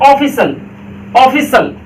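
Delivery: ऑफिशियल said as "ofisal", no "-iyal"; correct pronunciation. Shown as a demonstration. Teacher showing students the right word, said clearly. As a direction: say ऑफिशियल short, "o-fi-sal". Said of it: "तो आपको क्या बोलना चाहिए?".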